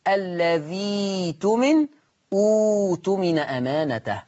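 الذي تمن with a long "ii", then أوت من أمانته restarted on a long "uu"; drawn-out Quran reading of الذي تمن and أوت من أمانته; skilled recitation. Shown as a demonstration. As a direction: recite slowly, stretching الذي and the start of أوت. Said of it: "وعايز أحترف القراية".

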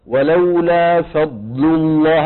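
ولولا فضل الله said very slowly; slow demo of ولولا فضل الله; slow-motion recitation. Shown as a demonstration. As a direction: stretch every sound of ولولا فضل الله. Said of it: "بالتصوير البطيء".

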